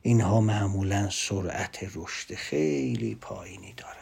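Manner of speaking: whisper. Real, not acted. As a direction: breathe it out as a confiding whisper. Whispered aside about slow thinkers.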